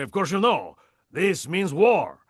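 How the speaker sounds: put-on high, loud, proper crab with a slight French accent; a prim cartoon crab, slow and declaring war; Mister Crab voice, slight French. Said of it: "proper like this and a little bit of a French accent, just a small bit".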